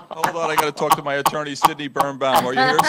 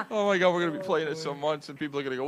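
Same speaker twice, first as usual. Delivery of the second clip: normal talking voice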